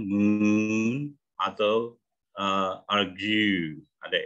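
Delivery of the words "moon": MOON with a long drawn-out oo; long vowel. Shown as a demonstration. Coach demonstrating the long sound to the class, drawn out. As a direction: hold the oo in MOON. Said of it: "agak panjang".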